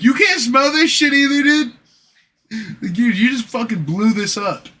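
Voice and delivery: high, loud cocky jab; mock coworker. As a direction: toss it off high and loud like a proud culprit. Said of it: "And it's like".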